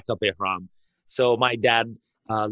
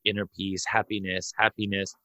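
lower, softer, soothing inner-peace mantra; mock serene calm; 4 semitones lower, 6 dB quieter than usual. To the police, calming himself with a mock serene mantra. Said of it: "I was like".

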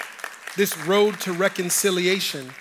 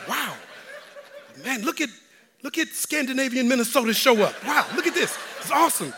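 high, laughing amazement; amazed laughing; 7 semitones higher than usual. Retold or imitated. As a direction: marvel at the crowd, laughing. Peeks out at crowd, amazed.

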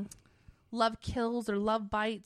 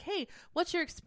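Sharon; 8 semitones higher than usual. Retold or imitated. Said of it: "so Sharon's like".

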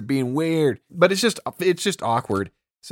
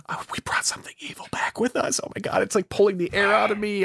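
higher, whispered, then breathless panic; hammed-up fright, as if the air is gone; mock panicked whisper; 7 semitones higher than usual. [name] panicking about evil.

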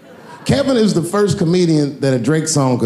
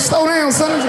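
scolding dad; 12 semitones higher, slower than usual. Retold or imitated. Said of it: "I was like".

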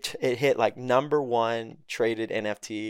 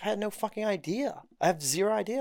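helpless retold protest; 9 semitones higher than usual. His retold helpless reply.

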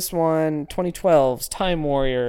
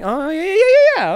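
excited recognition; 7 dB louder, faster than usual. Real, not acted. Sudden recognition of the joke.

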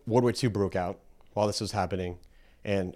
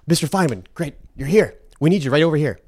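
higher, louder eager greeting; eager engineer greeting; 5 semitones higher, 6 dB louder than usual. Retold or imitated. Engineer welcoming Feynman to the blueprint room, eager. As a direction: hail the expert you have been waiting for.

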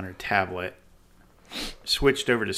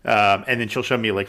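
usual speaking voice

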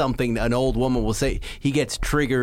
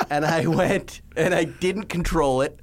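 normal talking voice

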